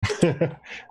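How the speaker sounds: quick high chuckle; short chuckle. Real, not acted. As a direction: chuckle at the alcohol question. Laughing before dwarf joke.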